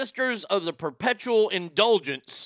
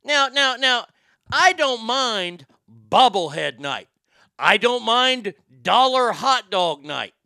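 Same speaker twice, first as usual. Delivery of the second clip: loud, slow, high-pitched protest; emphatic rant; 6 semitones higher, 6 dB louder than usual. Real, not acted. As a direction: hammer out each point, stretching the key words. Ranting against theme nights at games.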